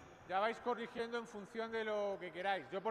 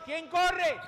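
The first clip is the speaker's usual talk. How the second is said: full shout, high and loud; barking gym coach; 6 semitones higher, 11 dB louder than usual. Real, not acted. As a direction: yell it across the court. Coach's third, loudest call.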